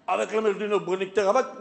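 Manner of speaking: low-pitched parody of a singer. Acted. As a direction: impersonator doing a second impersonation, low. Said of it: "Voici donc [name] qui imite [name]". (imitation parodique, voix grave)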